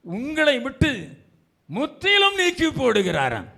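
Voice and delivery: high, ringing proclamation; impassioned preaching. Real, not acted. Preacher promises the congregation their shame will go, impassioned. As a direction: lift the voice high, then let it fall.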